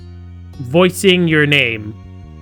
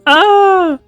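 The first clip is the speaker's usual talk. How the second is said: short, very high cartoonish scream; high loud shriek; 8 dB louder than usual. Gamer reacting to own silly impression, high shriek.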